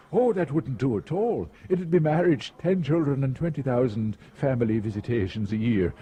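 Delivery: lightly voiced cheerful Irishman; a hint of a jovial Irish rogue; lively Irishman. Michael mocks marriage.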